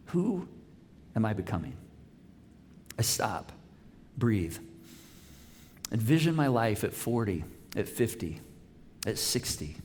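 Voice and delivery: low, hushed, pause-laden reading; grave, reflective reading. Acted. Pastor reads an author's troubled self-examination to his congregation, grave and hushed. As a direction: read it softly, lingering between short phrases. Reads a book excerpt aloud.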